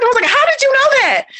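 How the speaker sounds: high, loud, amazed question; astonished. Retold or imitated. Mom to her kid who knew the answer, astonished.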